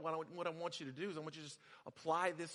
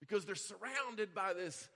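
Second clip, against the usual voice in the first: normal talking voice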